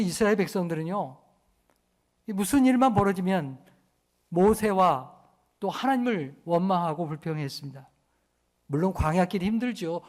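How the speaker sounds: usual speaking voice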